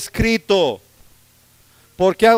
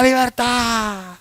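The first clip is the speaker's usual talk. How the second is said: drawn-out cry of freedom; 3 semitones higher, much slower than usual. Retold or imitated. Preacher retelling William Wallace's last cry, stretched out. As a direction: stretch Libertad out slowly on one breath. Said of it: "viendo cómo gritaba".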